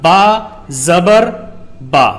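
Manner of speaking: teacher demonstrating how to read a letter with zabar; spelled syllable demo. Shown as a demonstration. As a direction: say the letter, the mark, then the sound. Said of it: "تو اس کو پڑھنے کا طریقہ رہے گا".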